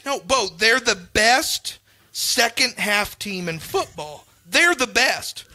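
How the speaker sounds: put-on slowed, spelling it out for the kid; slow, careful re-explaining; slow patient explaining. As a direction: lay each word down slowly.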